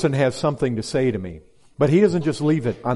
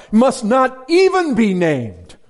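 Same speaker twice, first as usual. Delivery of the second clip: "Must not even be named" loud, high and drawn out; emphatic prohibition; 8 semitones higher, 7 dB louder, slower than usual. Real, not acted. Preacher stressing a prohibition.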